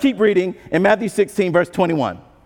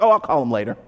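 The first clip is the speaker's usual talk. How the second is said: brushing it off; 7 semitones lower than usual. Retold or imitated. Churchgoer putting off a call, lazily dismissive. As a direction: shrug off the nudge, lazy and low. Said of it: "and we're like".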